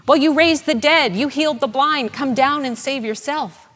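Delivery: loud, high-pitched jeer at a helpless man; mocking taunt. Crowd mocks Jesus on the cross, loud mocking jeer. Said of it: "They mock his ministry".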